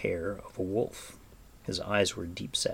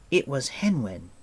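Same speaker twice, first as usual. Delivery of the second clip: put-on slightly raised boyish protest; 4 semitones higher, 4 dB louder than usual. A narrator's reading. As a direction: protest that it wasn't you. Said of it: "Taran protested".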